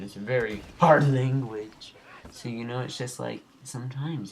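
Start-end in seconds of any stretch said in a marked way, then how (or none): 0.2-1.9 abrupt loud, drawn-out outburst; sudden flip-out. Pretend. Gamer startling viewers about English, sudden loud flip-out. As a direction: flip out loudly on the words. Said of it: "flip out like that".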